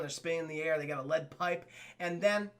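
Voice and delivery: usual speaking voice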